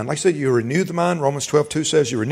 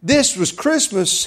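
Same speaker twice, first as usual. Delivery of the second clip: loud, slow whoa of wonder; amazed sinner; 6 semitones higher, 7 dB louder, much slower than usual. Retold or imitated. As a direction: gasp at the news. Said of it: "They say".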